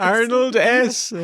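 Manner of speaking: high, slow put-on voice; mock voice. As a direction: stretch the name out, playing a role. Mimicking the signature in a voice.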